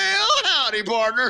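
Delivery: high, hammed-up Southern prospector greeting. Co-host showing off the accent inventory, hammed-up Southern prospector. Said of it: "Southern prospector".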